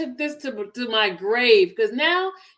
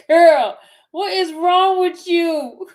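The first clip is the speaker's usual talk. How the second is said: exasperated outcry; 3 semitones higher, 9 dB louder, slower than usual. Real, not acted. Reacting to the woman in the clip, a loud exasperated outcry. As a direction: cry out in disbelief at her.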